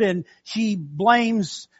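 blames stretched very slowly; "blames" said long and slow; drawn-out emphasis. Real, not acted. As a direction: linger on "blames" for weight. Storytelling emphasis.

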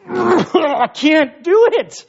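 slow, high, pinched refusal; disgusted refusal. Retold or imitated. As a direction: recoil from the corn. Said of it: "there with them like".

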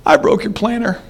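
put-on a boy crying out his sorry. Johnny confessing the broken planter to grandma, high and tearful. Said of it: "Starts crying".

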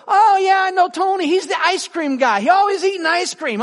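light up as the name clicks; delighted recognition. Retold or imitated. Wife recalling the ice cream guy, loud high delight.

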